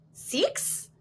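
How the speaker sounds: Australian "Six" with a high rise, whispered; imitating an Australian saying "Six"; Australian accent. Friend's claim about Australians, light Australian accent. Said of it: "Australians would say".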